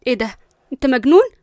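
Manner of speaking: shocked, accusing exclamation. Acted. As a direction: burst out in disbelief, voice jumping up. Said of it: "جود بصت له بصدمة".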